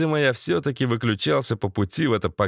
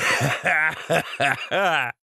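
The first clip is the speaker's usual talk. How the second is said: higher-pitched, jeering cackle; gurgling laugh; 4 semitones higher than usual. A narrator's reading. Beaten captive laughing at his tormentors, gurgling cackle. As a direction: laugh on through a mouthful of blood. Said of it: "булькал я кровавыми пузырями".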